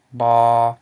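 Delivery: "ba" held long and loud at mid pitch. Shown as a demonstration. Teacher models the Lao word for students, mid tone on "ba". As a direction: keep "ba" level in the middle of the voice. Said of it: "a mid-tone marker".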